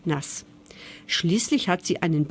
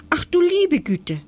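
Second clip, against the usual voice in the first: erschrockener Ausruf; 9 semitones higher, 3 dB louder than usual. Acted. Maus erschrickt über Gewitterwolken.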